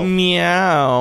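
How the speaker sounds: high, loud playful meow; cat meow. Pretend. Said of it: "that was my Thirsty Lion impression".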